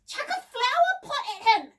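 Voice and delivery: put-on high, loud girl's shout; urgent high-pitched yell. A narrator's reading. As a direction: shout the order, voice way up. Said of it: "shouted Debbie".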